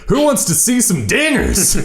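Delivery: high, hammed-up swaggering brag; cocky slugger voice. Podcast host mimicking Drew's cocky brag. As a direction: play the showboat at batting practice. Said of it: "He's like".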